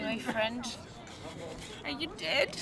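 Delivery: ordinary talk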